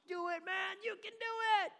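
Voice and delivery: very high, excited cheer; hammed-up cheering tourist; cheering fan. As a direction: egg him on like a fan in the crowd.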